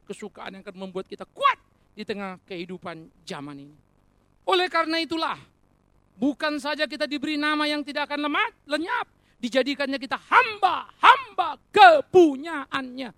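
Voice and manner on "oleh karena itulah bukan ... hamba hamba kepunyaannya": loud, high pulpit proclamation; impassioned raised voice; full-force preaching. Real, not acted. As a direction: proclaim it with rising force, hammering the last words. Preacher building to a climax.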